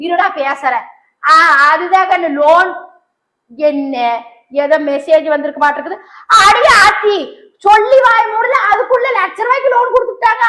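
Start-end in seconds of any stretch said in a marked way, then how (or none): 6.4-10.4 high, loud astonished cry; shocked. Acted. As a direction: reel at the sudden news, higher and louder. Loan arrives instantly, shocked mother.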